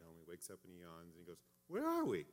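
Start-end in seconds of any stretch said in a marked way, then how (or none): 1.7-2.3 put-on high, small voice of a just-woken three-year-old; toddler voice. Son waking in the car, high toddler voice. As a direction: squeak it like a groggy little boy.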